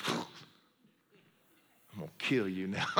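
low, soft, deadpan threat through a laugh; a hint of an unstoppable brute; the pipe-struck guy threatening the friend, low and amused; mock menace. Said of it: "And the guy just went".

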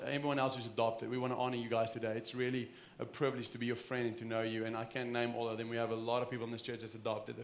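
usual speaking voice

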